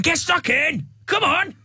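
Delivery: high-pitched mock sideline shouting; aggressive parent yell. Impersonating parents at a kids' football match, aggressive high yelling. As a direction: yell it like a pushy touchline parent. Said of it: "like, aggressive they get".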